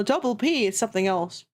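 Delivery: ordinary talk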